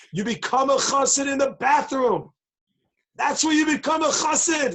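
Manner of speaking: loud, high preaching declaration; emphatic proclamation. Real, not acted. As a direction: proclaim it like a great revelation. Preacher proclaims his shocking point.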